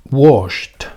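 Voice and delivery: washed said as one syllable ending in a crisp t. Shown as a demonstration. Teacher models washed for students, one syllable with a final t. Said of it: "su pronunciación sería con T".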